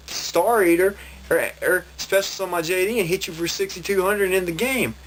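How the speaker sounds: retelling his own heated protest; a player losing patience with his cheating opponent, exasperated and high-pitched; frustrated. Retold or imitated. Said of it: "really frustrated the longer it went".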